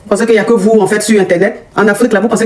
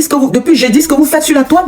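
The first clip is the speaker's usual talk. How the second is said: ordinary talk, nothing marked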